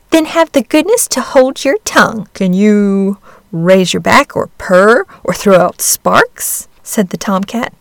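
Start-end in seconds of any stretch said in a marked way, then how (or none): 0.1-2.3 haughty hen. Acted. Hen scolds duckling. 2.3-6.7 slow, lofty cat voice; snooty bored cat. Acted. Tomcat questions duckling.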